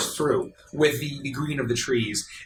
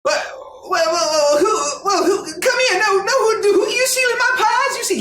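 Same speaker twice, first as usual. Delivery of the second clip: put-on high, loud old-man sputter; old baker; 4 dB louder than usual. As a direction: splutter like an outraged old shopkeeper. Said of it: "and he says".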